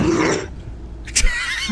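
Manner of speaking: high squealing laugh; burst of laughter. Real, not acted. Bursts out at her meltdown.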